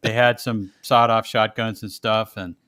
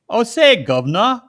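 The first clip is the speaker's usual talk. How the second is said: a lightly put-on British accent, said high; a quick, hammed-up British greeting to the governor; 11 semitones higher, 8 dB louder than usual. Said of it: "that's probably the wrong accent".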